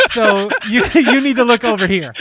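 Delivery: loud, high, laughing wife's scolding; mock wife scolding. Husband imitating his wife shutting down the bike. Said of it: "she was like".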